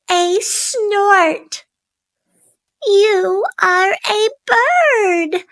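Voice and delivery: high, tender little bird, a touch tearful; a chick overjoyed to find mum; happy baby bird. Acted. Baby bird greets its mother.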